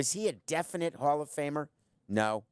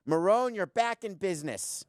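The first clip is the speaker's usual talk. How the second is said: high-pitched announcing call; raised, emphatic shout-out; 7 semitones higher than usual. Real, not acted. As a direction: call it across the studio, pitched up. Calls out to a replacement producer.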